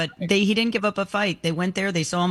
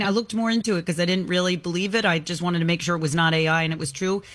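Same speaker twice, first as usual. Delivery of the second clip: ordinary talk, nothing marked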